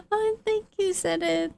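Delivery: squealed very high thank-you; delighted high-pitched thanks to a subscriber; excited thanks. Real, not acted. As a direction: gush it out way up high.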